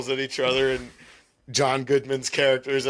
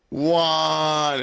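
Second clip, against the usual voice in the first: retold yell; 6 semitones higher, 3 dB louder than usual. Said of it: "and he yells".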